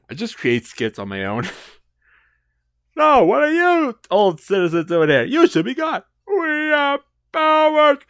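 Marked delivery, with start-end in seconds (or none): 3.0-8.0 high-pitched cartoon skit voice; skit character. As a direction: declaim in a high, put-on hero voice. Voices figures in own skit.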